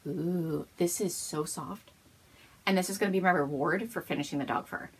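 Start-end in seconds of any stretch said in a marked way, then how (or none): 0.0-1.9 cooing. Pretend. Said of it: "touch the screen and be like".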